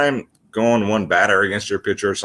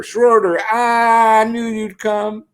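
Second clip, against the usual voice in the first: high, slow coo to the cat; doting pet talk; 11 semitones higher, much slower than usual. Real, not acted. Greeting Schroeder the cat as he comes over, high slow coo. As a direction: croon his name much higher and slow. Cat arrives for home run picks.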